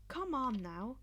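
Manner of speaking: normal talking voice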